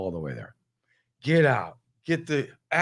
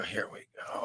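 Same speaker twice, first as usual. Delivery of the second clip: whispered to himself; 4 semitones lower, 13 dB quieter than usual. Retold or imitated. Muttering to himself in traffic.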